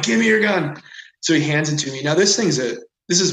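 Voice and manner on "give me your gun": re-enacted demand, high and loud; urgent yell. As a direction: call out urgently, as in the retelling. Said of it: "I, like, yelled to the guide".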